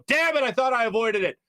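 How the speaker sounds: high-pitched comic frustration; mock exasperation. Retelling his reaction to the gift, mock exasperated cry. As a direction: curse your luck, hammed-up and high. Retold reaction to being iced.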